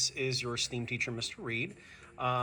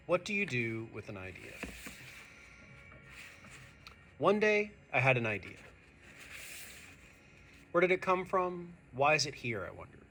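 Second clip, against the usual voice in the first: expressive read-aloud storytelling, a bit higher and brighter than his chat; story narration; 4 semitones higher, 5 dB louder than usual. A narrator's reading. Teacher reading a picture book to his students, expressive storytelling voice.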